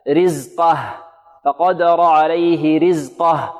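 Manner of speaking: رزقه with the ق thinned, open light vowel; slow recitation, ق in رزقه lightened by mistake; wrongly light qaf. Shown as a demonstration. Teacher showing students what to avoid, thinned-qaf demo. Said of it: "أن ينطقها مرققة هكذا".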